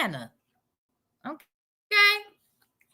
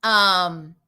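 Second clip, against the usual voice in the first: ordinary talk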